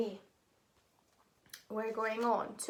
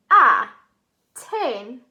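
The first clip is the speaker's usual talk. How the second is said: "A-ten-tion" very slow and loud, syllable by syllable; teacher's loud, slow sounding-out of "A-ten-tion"; saying the syllables; 15 dB louder, much slower than usual. Shown as a demonstration. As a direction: break "A-ten-tion" into three emphatic chunks with long pauses. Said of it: "we're going to say the syllables".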